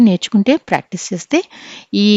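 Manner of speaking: ordinary talk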